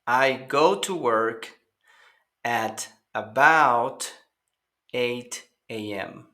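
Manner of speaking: slow, deliberate English example; slow teacher model; slow model sentence. Shown as a demonstration. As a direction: spell it out slowly for repetition.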